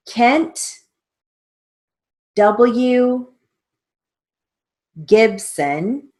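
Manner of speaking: slow, deliberate dictation read with pauses. Shown as a demonstration. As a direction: dictate each word slowly and clearly for the class. Dictation with spelled name.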